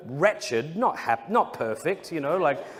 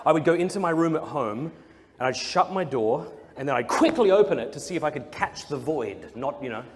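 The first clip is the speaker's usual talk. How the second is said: ordinary talk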